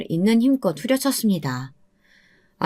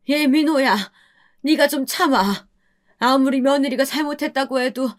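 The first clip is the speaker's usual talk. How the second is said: higher, tearful scolding cry; crying mother shouting; 7 semitones higher than usual. Acted. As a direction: stop the fight through her tears. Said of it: "어머니는 울면서 저희에게 소리치셨습니다". (울면서 자식들을 꾸짖는 어머니)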